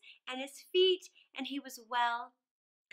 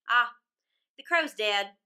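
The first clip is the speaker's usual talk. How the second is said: put-on louder, bold teasing robber girl; brash robber girl; 3 semitones lower, 10 dB louder than usual. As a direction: tease him like a cocky bandit girl. Robber girl answers Gerda.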